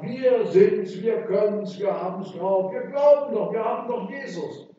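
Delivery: rousing boast, rising in pitch; mock-triumphant declaration; youthful overconfidence. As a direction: parody a cocksure young believer. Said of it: "da habe ich mir wirklich vorgestellt".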